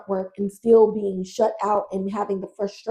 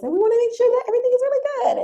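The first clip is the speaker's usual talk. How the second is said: much higher, fast, sugary gossip's voice; Missus Fish's sweet voice; 13 semitones higher, much faster than usual. Retold or imitated. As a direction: put on a falsely sweet high society lady. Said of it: "she talks like this".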